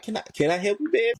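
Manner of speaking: high, sing-song Southern lady offering help; Southern store lady. Retold or imitated. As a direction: mimic the bookstore lady, pitched high. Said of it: "the lady was in there like".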